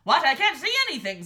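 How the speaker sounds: loud startled exclamation, pitch jumping up; startled king. A narrator's reading. King who can't see what's happening, startled and loud. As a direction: blurt it out loudly as the startled king.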